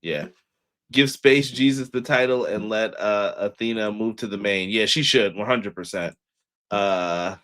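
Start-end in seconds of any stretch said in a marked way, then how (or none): none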